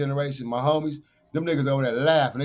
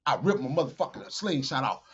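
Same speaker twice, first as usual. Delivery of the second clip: ordinary talk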